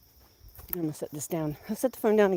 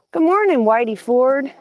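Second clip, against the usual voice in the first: sing-song greeting; 7 semitones higher, 5 dB louder than usual. Real, not acted. Calling to the rooster.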